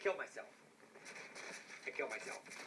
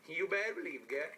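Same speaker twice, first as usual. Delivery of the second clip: normal talking voice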